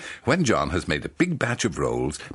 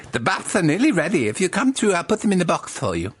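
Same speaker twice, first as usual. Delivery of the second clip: higher, louder helpful John; 10 semitones higher, 4 dB louder than usual. Acted. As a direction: play the eager shop helper, pitched up. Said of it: "John says".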